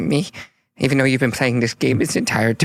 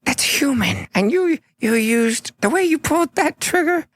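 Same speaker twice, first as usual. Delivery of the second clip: high, slow, starstruck gnome voice; gushing little gnome. Acted. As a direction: marvel at him like a small fan. Smoggle gushing over Clive.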